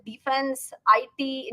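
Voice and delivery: ordinary talk, nothing marked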